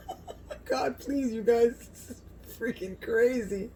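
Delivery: high, giddy laughing exclamation; giddy amazement. Real, not acted. Cook to viewers over the sliced tenderloin, giddy amazement. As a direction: squeal it, thrilled and half laughing.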